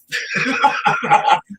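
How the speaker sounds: high breathy burst of laughter. Real, not acted. Uncle laughing at his sister's praise.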